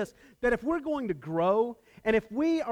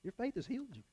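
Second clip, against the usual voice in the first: much softer, gentle words to the son; tender quiet; 5 semitones higher, 11 dB quieter, faster than usual. Acted. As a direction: speak it softly, like a blessing. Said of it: "Jesus looks at him and says".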